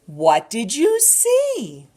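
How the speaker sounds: slow, animated picture-book reading; drawn-out storytime voice with gasps; reading to a toddler. Acted.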